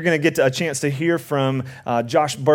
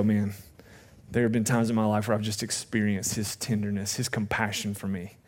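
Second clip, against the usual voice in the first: much softer, lower, reflective voice; soft and inward, as if remembering; hushed testimony; 7 semitones lower, 10 dB quieter than usual. Retold or imitated. Pastor voicing a moved believer to the congregation, softly hushed.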